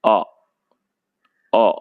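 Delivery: short अ sounded out clearly; vowel demo. Shown as a demonstration. Teacher demonstrating the short vowel to children, clear अ. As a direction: say अ crisp for the class. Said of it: "हम उसे इस तरह बोल सकते हैं".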